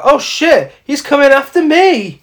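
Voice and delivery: high, loud hammed-up alarm; mock panic. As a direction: squeal it like a horror-film victim who spots the killer. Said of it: "and she's like".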